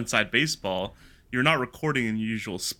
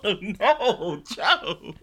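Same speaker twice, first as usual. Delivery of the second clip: mock concern; 4 semitones higher, 6 dB louder than usual.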